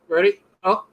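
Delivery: ordinary talk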